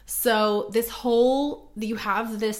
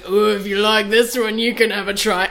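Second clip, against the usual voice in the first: lewd loud line; 2 semitones higher, 7 dB louder than usual. Retold or imitated. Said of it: "switching from him going like".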